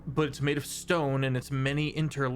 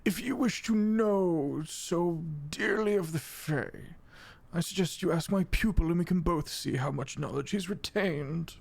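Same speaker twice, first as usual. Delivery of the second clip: high, measured, lofty character voice; haughty fey mentor; 4 semitones higher than usual. Acted. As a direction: propose the test grandly, starting high and slow. Fey mentor challenges player.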